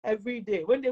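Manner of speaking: ordinary talk, nothing marked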